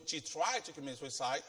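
usual speaking voice

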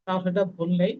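normal talking voice

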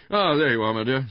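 high, loud, hearty husband; Mister Bland, greeting. A narrator's reading. Husband greeting his wife as she walks in, loudly hearty.

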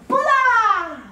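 loud, high "Bula!"; Fijian greeting called out. Shown as a demonstration. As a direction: call "Bula" out cheerfully. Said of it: "Let's say bula".

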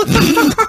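high burst of laughter; mock cackle. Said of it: "like that, laughed".

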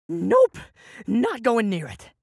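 loud frantic refusal; panicked yell. Acted. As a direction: shout it while scrambling away. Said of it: "The scream tore from my throat".

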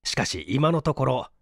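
ordinary delivery, nothing marked

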